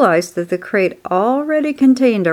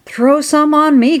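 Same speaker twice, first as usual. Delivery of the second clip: high, eager call; boy's cry; 7 semitones higher, 3 dB louder than usual. A narrator's reading. Hot boy wanting water too, crying out. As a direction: call out for a share like an eager kid. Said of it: "cried Avery".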